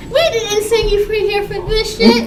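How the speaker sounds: angry relative. Retold or imitated. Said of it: "Another's gonna be like".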